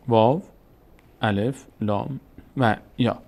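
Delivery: واو، الف، لام و یا spelled out slowly, one letter at a time. Shown as a demonstration. Teacher spelling the memory word والی for students, slowly. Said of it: "واو رو اگر بگذاریم اولش".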